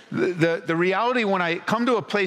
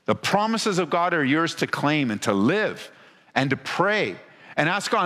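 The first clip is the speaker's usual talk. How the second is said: usual speaking voice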